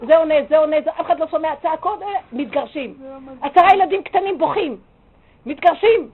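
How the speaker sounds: loud, high escalating outcry; dramatised alarm. Acted. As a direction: shout out the quarrel as it spirals into catastrophe. Dramatises a quarrel escalating to divorce. (צעקה דרמטית של אסון מתגלגל)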